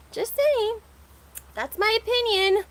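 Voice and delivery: high, playful singsong tag; sassy sign-off. Real, not acted. To her viewers after the affair gossip, a sassy high sign-off. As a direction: toss it off cheekily.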